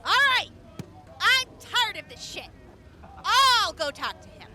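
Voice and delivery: fed up. Acted. Siobhan fed up with the arguing, loudly exasperated. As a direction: snap that you're done with this.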